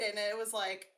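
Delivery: ordinary delivery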